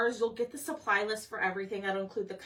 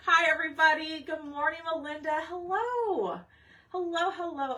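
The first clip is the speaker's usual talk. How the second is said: laughing greeting; 6 semitones higher, 4 dB louder than usual. Real, not acted.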